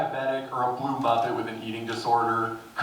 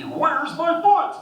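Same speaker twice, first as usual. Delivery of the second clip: tiger voice; 5 dB louder, much slower than usual. Pretend. Tiger ate too much.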